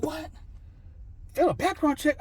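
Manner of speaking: high-pitched incredulous echo; retold disbelief. Applicant hearing he failed the check, incredulous. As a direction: question the bad news in high disbelief. Reacting to the rejection.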